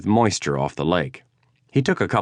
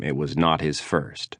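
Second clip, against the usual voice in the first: plain reading voice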